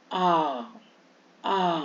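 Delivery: आ as one held "aa", no "war" glide; long aa sound. Shown as a demonstration. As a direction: say आ as a long open "aa". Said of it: "आवर नहीं बोलते".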